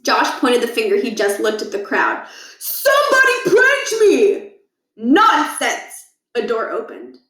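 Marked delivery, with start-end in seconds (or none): 2.6-4.6 outraged yell. Acted. Josh yells at crowd. 5.0-6.1 booming interruption. Acted. Principal interrupts.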